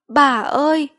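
high, loud wail of grief; bursting into tears. A narrator's reading. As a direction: cry out for grandmother, acted. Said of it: "cô vừa òa lên khóc". (òa khóc gọi bà)

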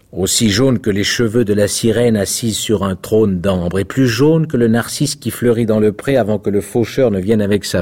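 rosier vaniteux, plus haut et plus fort; rosier fier. A narrator's reading. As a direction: se vanter de ses roses jaunes, lentement au début. Rosier jaune répond. (rosier jaune fier, voix plus haute et plus forte)

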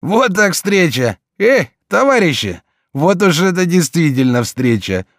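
put-on loud, slurred drunken greeting. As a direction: call out like a cheerful drunk. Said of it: "пьяный голос".

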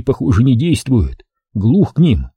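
ordinary delivery

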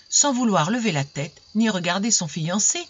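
plain reading voice

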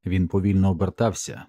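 plain reading voice

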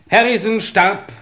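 laut, hoch und langsam verkündet; feierliche Verkündung. Acted. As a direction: sprich es gewichtig aus. Inspektor verkündet Befund. (der Inspektor verkündet allen den Befund)

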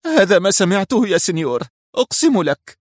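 high, desperate pleading cry; frantic high-pitched servant begging; utter breakdown. A narrator's reading. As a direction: swear it in a high, collapsing voice. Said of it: "هتف الخادم في انهيار تام".

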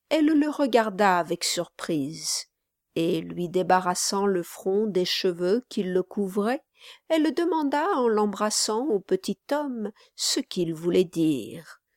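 ordinary delivery, nothing marked

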